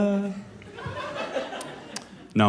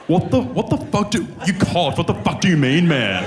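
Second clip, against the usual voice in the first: high mock-lad disbelief; higher mocking lad voice; popular kid incredulous; 7 semitones higher than usual. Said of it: "he'd be like".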